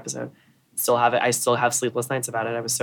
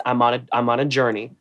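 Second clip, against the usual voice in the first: ordinary talk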